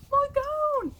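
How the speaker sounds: high, loud yell of delight. Acted. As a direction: shout it out, overjoyed to find your gown. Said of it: "he yelled".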